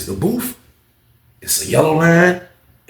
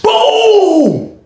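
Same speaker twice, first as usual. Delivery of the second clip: long, very high "boom" sound effect; imitated gunshot boom; 4 dB louder than usual. Storyteller imitating the shot, explosive boom. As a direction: let the blast ring out high and drawn out.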